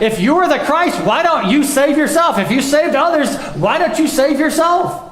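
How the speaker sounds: mock them like a jeering mob; preacher voicing the crowd's mocking taunt. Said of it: "all the people are yelling at him".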